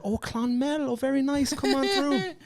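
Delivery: high-pitched imitation of a friendly official; welcoming border woman. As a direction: put on a high voice and wave them through. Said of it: "And she said".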